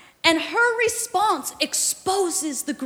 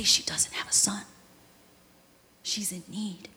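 1 hushed servant; 6 semitones lower, 10 dB quieter than usual. Retold or imitated.

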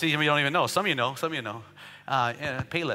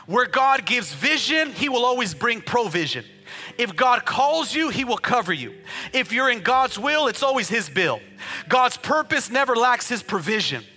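loud, higher rhyming proclamation; preaching cadence; 6 semitones higher, 6 dB louder, slower than usual. Acted. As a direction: preach each line to the back row. Preaches rhymed lines.

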